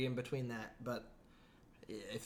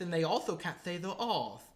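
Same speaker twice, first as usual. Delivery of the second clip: put-on lisping British accent without R's; 2 semitones higher than usual. Explaining a British speech quirk by imitating it, R-less. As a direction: imitate a Brit who can't pronounce R. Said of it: "they can't say their R's".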